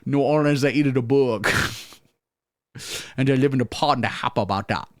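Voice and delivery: lightly voiced Cajun-German mix; mock guru with a bayou twang; Cajun Klaus Schwab. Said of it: "Cajun Klaus Schwab".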